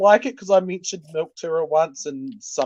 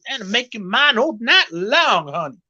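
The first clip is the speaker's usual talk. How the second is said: hammed-up smooth pickup, vowels stretched; friend's pimp-style catcall; 5 semitones higher than usual. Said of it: "I was doing an impression of one of my friends".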